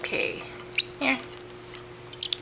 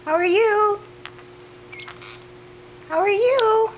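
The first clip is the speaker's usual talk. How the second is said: pet talk; 11 semitones higher than usual. Real, not acted. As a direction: pitch way up and call brightly to the bird. Greeting the parrot in pet talk.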